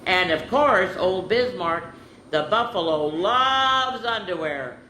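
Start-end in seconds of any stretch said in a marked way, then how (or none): 3.1-4.0 "loves" held long and high; emphatic stretch. A narrator's reading. Reader ending Bismarck's story, "loves" stretched high. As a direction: draw out "loves" with relish.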